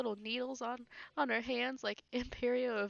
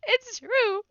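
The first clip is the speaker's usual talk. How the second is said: higher and louder, giggling through the taunt; laughing taunt; 6 semitones higher, 9 dB louder than usual. Real, not acted. Laughing while taunting opponent.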